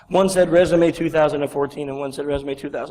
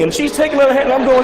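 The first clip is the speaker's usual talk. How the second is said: ordinary delivery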